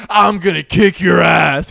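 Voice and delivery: loud, slow, threatening shout; angry mock threat. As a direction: play the furious boyfriend on the attack. Acts out angry boyfriend threatening.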